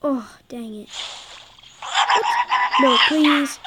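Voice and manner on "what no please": dismayed cry. Real, not acted. Losing the level.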